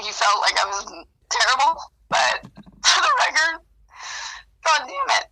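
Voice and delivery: laugh-crying, trying to get the words out anyway. Real, not acted. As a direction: pitch jumps up as the tears break through. Apologizing, breaking into tears.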